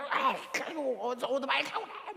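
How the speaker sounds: half-laughing imitation of an angry scold; laughing mock yell. (웃으면서 흉내 내는 호통)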